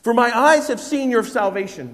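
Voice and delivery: proclaiming Simeon's song. Acted. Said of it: "he sings out this song".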